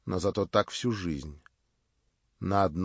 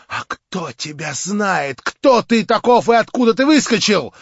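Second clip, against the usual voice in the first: much higher, louder, proud; Marya, defiant; 10 semitones higher, 11 dB louder than usual. A narrator's reading. Marya scorns her would-be prince, high and loud. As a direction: cry it out with haughty scorn.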